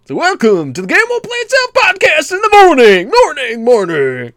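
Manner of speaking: high, loud radio shock jock; booming morning radio host. Pretend. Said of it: "like a Sunday morning, like, shock jock kind of a thing".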